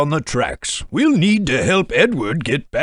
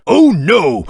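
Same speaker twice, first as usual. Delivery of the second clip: alarmed exclamation; 5 semitones higher, 7 dB louder than usual. Acted. Sees boulder on the track.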